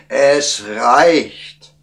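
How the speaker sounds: angry king calling a halt, high and loud; annoyed shout. A narrator's reading. King stopping the wise man's counting, annoyed. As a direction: cut him off with royal irritation. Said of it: "rief der König verärgert".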